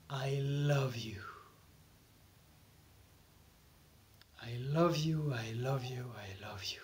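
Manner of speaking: slow, soft, low affirmation sinking into a whisper; hushed self-love mantra, fading away; guided meditation affirmation. Shown as a demonstration. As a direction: murmur it tenderly to your own heart, slowly.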